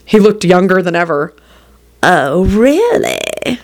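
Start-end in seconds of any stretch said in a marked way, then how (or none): 2.0-3.6 slow sultry tease. A narrator's reading. Rosie slow reply.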